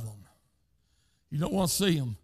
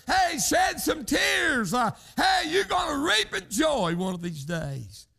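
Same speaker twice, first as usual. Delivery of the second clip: preaching at full force; 7 semitones higher than usual. Acted. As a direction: rise into a whooping preacher's climax. Preaching climax.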